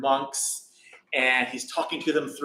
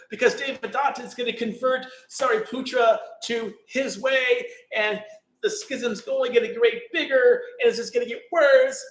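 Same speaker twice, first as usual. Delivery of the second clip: high, distressed plea, near tears; a worried monk fearing the worst; upset weeping monk; 9 semitones higher than usual. Retold or imitated. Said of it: "started weeping".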